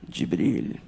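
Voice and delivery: very low, composed royal voice; dignified prince. Retold or imitated. Prince answering the comic's greeting, deep and dignified. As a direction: drop it deep and regal. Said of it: "And he's like".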